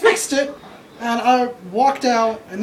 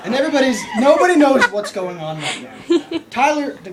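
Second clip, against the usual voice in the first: ordinary delivery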